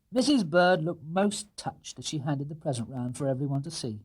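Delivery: ordinary delivery, nothing marked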